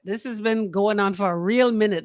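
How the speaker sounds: ordinary talk, nothing marked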